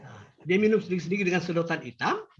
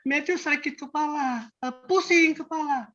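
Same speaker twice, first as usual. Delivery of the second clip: higher-pitched mock child's complaint; retold tearful complaint; 6 semitones higher than usual. Grandfather retelling the crying child's headache, mild high-pitched complaint. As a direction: imitate a hurting child, pitched up. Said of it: "Dia menangis".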